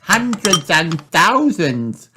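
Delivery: high, slow excited cry; delighted at the pile of batteries. Acted. Little girl amazed by spilled batteries, high slow excitement. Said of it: "cries Peppa".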